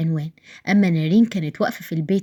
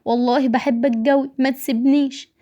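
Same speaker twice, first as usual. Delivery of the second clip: lightly acted tearful plea, pitched up; crying; 6 semitones higher than usual. A narrator's reading. Wife left behind begging her husband, mildly tearful high voice. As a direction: beg him to stay, voice raised in pitch. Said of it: "وهي بتعيط".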